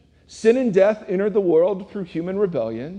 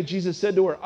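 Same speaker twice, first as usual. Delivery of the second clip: normal talking voice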